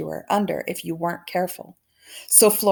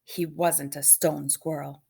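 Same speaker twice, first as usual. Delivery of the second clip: ordinary delivery, nothing marked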